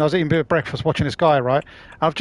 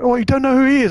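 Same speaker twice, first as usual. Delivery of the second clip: higher, louder incredulous question; mock disbelief; 8 semitones higher, 5 dB louder than usual. Streamer imitating friends shocked at him, mock disbelief. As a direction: act stunned that he hasn't heard of him.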